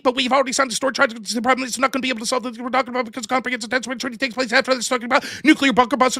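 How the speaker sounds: very fast, run-on auctioneer-like rattle; speed-debate practice. Acted. Debater practicing for a tournament, rattled off at breakneck speed. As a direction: race through the arguments without pausing. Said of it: "I watch this dude practice".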